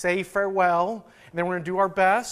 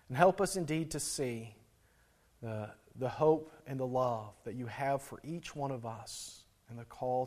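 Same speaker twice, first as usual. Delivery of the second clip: quiet prayer; 7 semitones lower, 11 dB quieter than usual. Acted. Pastor closing his prayer to the church, soft and reverent. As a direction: pray gently over the congregation.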